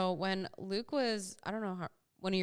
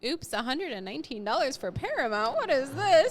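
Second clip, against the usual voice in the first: high, rising disbelief, louder at the end; imitated alarm, building to a loud high question; mock dismay; 6 semitones higher, 5 dB louder than usual. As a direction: play up the shock at a bill. Acting out shock at a charge.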